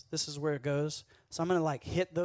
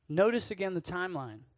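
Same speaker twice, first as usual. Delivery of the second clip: normal talking voice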